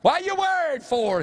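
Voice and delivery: imitating an old preacher's reassurance; Brother J T Lyons. Quoting a fellow believer telling folks not to worry, exclaiming. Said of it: "Brother J T Lyons".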